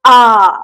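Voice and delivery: आ sung out loud with the mouth wide open; open vowel demo. Shown as a demonstration. Teacher showing students the open vowel, loud full आ. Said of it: "लगभग खुल रहा है ना".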